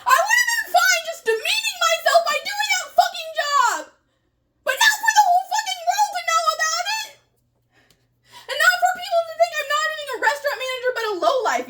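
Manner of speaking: high, tearful angry crying through the words; crying rant. Real, not acted. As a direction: sob out your fury, voice cracking high. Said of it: "You really mad about working at Jer".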